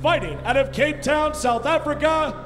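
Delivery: big booming fight-night introductions; boxing ring announcer. Acted. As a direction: stretch the names like a ring announcer.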